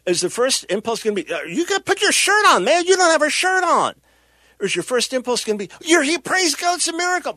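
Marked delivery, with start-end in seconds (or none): 1.3-3.9 high, raised-voice mock reprimand; indignant scolding. Acted scolding of the healed man. 5.8-7.3 high-pitched, acted burst of rejoicing; jubilant praise.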